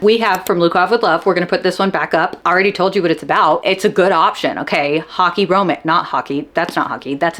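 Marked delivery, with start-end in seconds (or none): none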